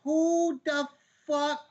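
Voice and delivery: high-pitched, indignant yelling, slowing on the last words; exasperated yell, pitched well up; yelling rant. Real, not acted. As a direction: rant it out high, hammering each word. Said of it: "why you're yelling about it".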